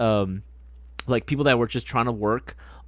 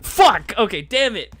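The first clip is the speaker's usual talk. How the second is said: loud, high curse; real frustrated outburst; 8 semitones higher, 6 dB louder, slower than usual. Real, not acted. Player whose guess about a friend missed, loud frustrated cursing. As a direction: burst out at losing the point.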